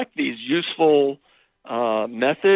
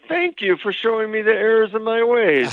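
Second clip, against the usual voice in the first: mock grateful admission; 7 semitones higher than usual. Counselor imagining a wife happily admitting fault, mock grateful. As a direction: gush it in a higher, mock-thankful voice. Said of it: "did she respond by saying".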